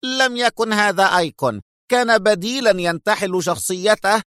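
heated insistence; sharp. A narrator's reading. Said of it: "قال إيتان في حدة".

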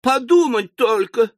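high indignant exclamation; exclaiming. Acted. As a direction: cry out in shocked dismay. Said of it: "воскликнула мисс Напкинс".

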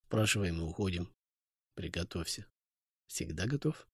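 low, hushed reply. Acted. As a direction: keep it down, wary. Hushed reply in tense tavern.

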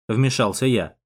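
plain reading voice

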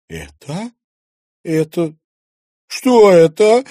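put-on a shaken girl who can barely get the words out; голос дрожит от страха; trembling voice. A narrator's reading. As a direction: voice quivering with fear, rising to a loud frightened cry. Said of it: "дрогнувшим голосом спросила".

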